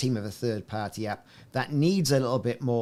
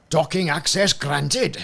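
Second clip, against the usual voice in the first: put-on slow Welsh station-controller accent; Windsor Davis Welsh accent; 4 semitones higher, 7 dB louder, slower than usual. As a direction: announce docking clearance in a lilting Welsh accent, slowly. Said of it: "In my Welsh accent voice like that".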